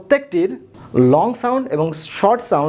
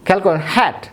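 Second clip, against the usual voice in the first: ordinary talk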